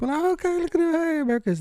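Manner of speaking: very high-pitched mock American; loud American. Mimicking Americans and their vibe, high mock-American voice. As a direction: squeal it like a hammed-up American tourist. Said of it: "They're very loud".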